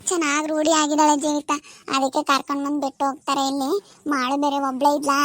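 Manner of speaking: normal talking voice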